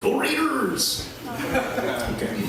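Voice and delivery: high, slow Mexican dad cheer; drawn-out East LA Mexican accent cheer. Retold or imitated. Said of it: "working on the accent".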